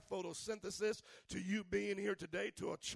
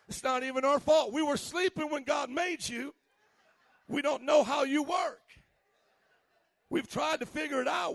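loud, higher pleading preacher; full-force preaching; 6 semitones higher, 9 dB louder than usual. Acted. Preacher shouting to the congregation about women.

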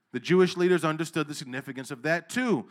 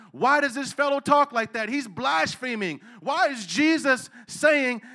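preaching at full force; 6 semitones higher, 6 dB louder than usual. Acted. Pastor voices the teachers' outrage to the congregation, preaching loud. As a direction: rise up and preach it out.

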